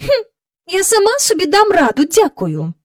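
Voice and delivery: high, loud huff dropping on the last word; snort, then refuse help huffily; snorted, huffy. A narrator's reading. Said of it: "пирхнула Мері Поппінс".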